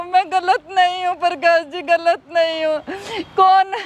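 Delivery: usual speaking voice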